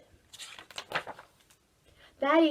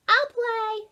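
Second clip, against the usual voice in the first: much higher, louder excited "me, me"; eager boys; 12 semitones higher, 10 dB louder than usual. A narrator's reading. Boys to Ruby, a much higher, louder eager shout. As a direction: jump at the chance.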